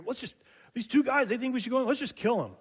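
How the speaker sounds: angry mob voice. Retold or imitated. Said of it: "And they go".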